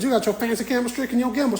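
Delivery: high, rapid mock question; hammed-up high-pitched parroting. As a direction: echo the caricature, quick and high. Echoes her put-on voice in reply.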